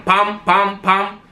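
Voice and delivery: high, punchy gunshot noises; mock gunshots. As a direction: spit out pam pam like shots. Imitating gunshots in robbery story.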